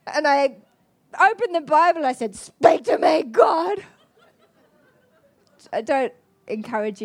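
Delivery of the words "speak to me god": much louder shout to God, laughing at herself; demanding shout. Retold or imitated. She demands an answer from God on her bed, shouting. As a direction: yell it like a tantrum.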